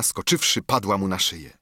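plain reading voice

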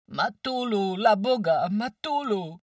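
loud, high-pitched pleading cry; pitiful wailing. A narrator's reading. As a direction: wail desperately for mother. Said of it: "skamlał żałośliwie". (żałośliwy, wysoki lament)

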